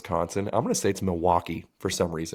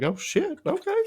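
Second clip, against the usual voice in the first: high, surprised yelp of alarm; startled. Retold or imitated. Speaker startled by the woman's offer, high surprise. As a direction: caught off guard, voice jumps up.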